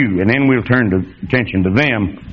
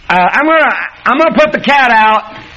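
questioning wife; 10 semitones higher than usual. Pretend. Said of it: "Did you ever say".

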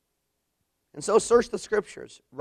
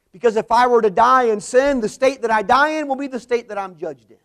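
higher, louder preaching warning; full-force preaching; 4 semitones higher, 9 dB louder than usual. Acted. Preacher warning the congregation about judgment, full-force preaching. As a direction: ring it out from the pulpit. Preacher warns of dying in sin.

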